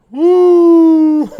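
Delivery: loud high cheer into laughter; victory whoop. Real, not acted. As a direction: celebrate the win wildly. Victory after the battle.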